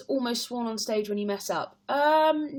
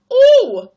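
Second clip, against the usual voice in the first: put-on high, loud old lady's startled ooh; granny-ish ooh; 18 dB louder than usual. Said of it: "I made a very old lady granny-ish type noise".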